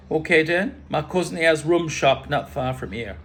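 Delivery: badly faked Caribbean lilt; bad Caribbean accent. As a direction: jovially invite in a mock Caribbean accent. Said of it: "Bad accent".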